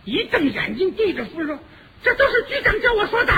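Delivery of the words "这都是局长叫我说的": high, loud tattling bird voice; blurting bird. Acted. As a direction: spill the secret in a shrill squawk. (急吼吼地学鸟告状)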